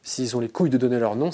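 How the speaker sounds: ordinary talk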